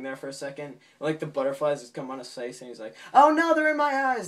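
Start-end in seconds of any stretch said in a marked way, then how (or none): 3.1-4.3 very loud, high-pitched wail; mock panic. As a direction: scream it in hammed-up cartoon terror. Acted panic, butterflies in eyes.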